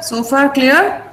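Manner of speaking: ordinary talk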